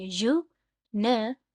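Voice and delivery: "Universe" very slow, यू, नि, verse; syllable by syllable. Shown as a demonstration. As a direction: leave gaps between the three syllables of "universe". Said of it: "इनको टुकड़ों में बांटेंगे". ("Universe" तीन टुकड़ों में, रुक-रुककर)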